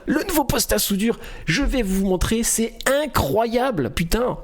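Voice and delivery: high, punchy, thrilled product intro; lively hype pitch. Shown as a demonstration. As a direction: sell it with big upbeat energy, stretching the key word. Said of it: "vous mettez de l'entrain".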